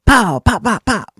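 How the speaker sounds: loud high popping noises; tire pops. Retold or imitated. Stabbed tires bursting, loud high pops.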